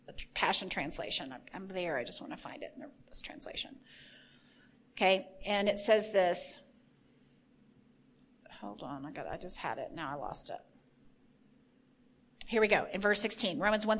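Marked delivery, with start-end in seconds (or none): 0.1-3.8 very low, hushed talk to oneself; absent-minded mutter. Real, not acted. Preacher hunting for a Bible translation, muttering quietly. Searching for the verse aloud. 8.6-10.6 low, barely voiced aside; muttering to oneself. Real, not acted. Preacher who just lost the verse, muttering softly. Lost her place, talking to herself.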